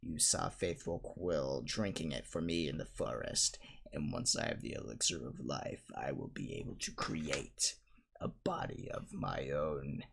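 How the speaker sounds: low, slow, distant menacing rasp; low, slow, menacing Voldemort. Acted. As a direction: speak as the face on the back of the head.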